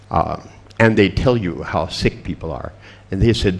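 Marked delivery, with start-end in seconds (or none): none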